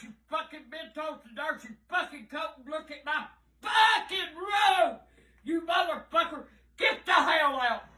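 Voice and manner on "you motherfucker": ordinary talk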